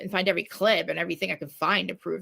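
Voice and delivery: ordinary talk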